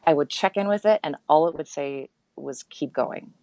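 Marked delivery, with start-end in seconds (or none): 2.6-3.3 softly voiced inner encouragement; a hint of a quiet inner guide; soul voice. Inner voice answers her doubts, soft soul voice. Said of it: "sort of like the divinely inspired voice".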